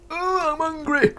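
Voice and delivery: very high, slow, whiny moan; hungry whine. Pretend. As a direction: whimper it like a starving pet. Pulling a hungry face.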